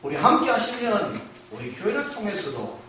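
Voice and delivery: usual speaking voice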